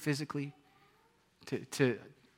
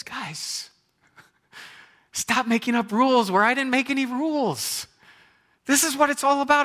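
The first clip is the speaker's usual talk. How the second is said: higher, louder, slowed-down plea; impassioned Jesus; 8 semitones higher, 4 dB louder than usual. Retold or imitated. Jesus urges the Pharisees, fervent high pleading. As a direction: preach it as Jesus with fervour. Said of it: "Jesus' little sermon".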